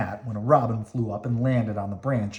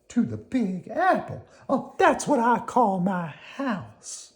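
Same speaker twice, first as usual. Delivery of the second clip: high, slow worm voice, proud; Squirmy proud; 9 semitones higher, slower than usual. Acted. Squirmy shows Slimy her apple house, in a high proud voice. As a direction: show off the apple home.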